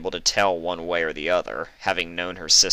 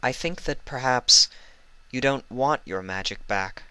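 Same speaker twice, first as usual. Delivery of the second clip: gentle; 5 semitones higher than usual. Acted. Celestia gentle suggestion.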